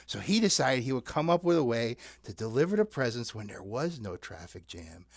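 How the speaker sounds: ordinary talk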